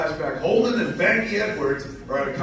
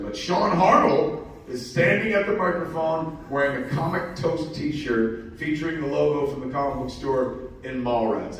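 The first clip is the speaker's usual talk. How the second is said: ordinary talk, nothing marked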